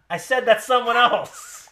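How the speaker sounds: loud, high, slow repeat; mock yelling. Repeating himself to an unresponsive co-host, mock yell. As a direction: yell it, drawing the words out. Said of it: "I don't wanna yell at".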